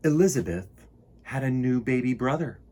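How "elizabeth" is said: usual speaking voice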